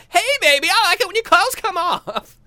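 very high, loud hammed-up come-on; squeaky mock seduction; mock sexy voice. Comic leering at a woman, high mock sexy voice. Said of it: "That's my sexy bedroom voice".

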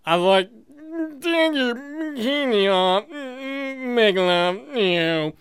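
put-on halting, mushy drunk mumbling; exaggerated drunken come-on, barely articulate; slurred drunk. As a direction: slur the words, stretching them out. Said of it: "but it was in drunk".

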